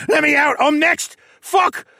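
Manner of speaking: high, loud, frantic cries; panicked shouting. A narrator's reading. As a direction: shout in terror, struggling to get free. Bound prisoner panics after arrow kills partner.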